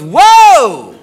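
loud, high swooping whoa; big mock-shocked outburst; startled whoa. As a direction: act out a shocked girlfriend's cry. Girlfriend's startled reaction acted out.

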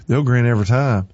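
ordinary delivery

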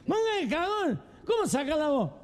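put-on high, slurred drunken heckler, dragging the vowels. Drunk man butting in on who gets the voice, drunken high squawk. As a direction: rant in a high, stretched-out drunk voice. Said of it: "Y un borracho dice".

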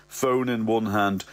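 ordinary delivery, nothing marked

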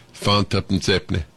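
Leeds market seller, "tuppence ha'penny" run together; Yorkshire accent. Retold or imitated. Market seller naming the price on first day in Leeds, Yorkshire accent. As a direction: lightly imitate a Yorkshire trader. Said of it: "And he said".

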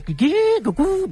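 high sing-song two-tone chime; imitated cabin chime. Nervous flyer showing the pilot the cabin noises, imitated chime. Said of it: "sé cuándo suena".

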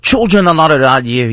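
storyteller briefly raising the voice for a shouted order; retold officer's shout. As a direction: call it out like an officer giving an order. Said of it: "he shouts".

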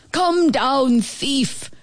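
high-pitched barking call; barked order. A narrator's reading. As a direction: bark it up at the thief. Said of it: "Max barked".

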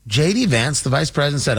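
normal talking voice

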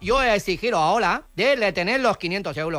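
put-on foreign accent with L for R; foreign-accented broken Spanish; mock foreign accent. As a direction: play a foreigner mangling Spanish, R turned to L. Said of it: "si no sabe hablar castellano".

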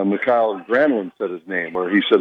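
ordinary talk

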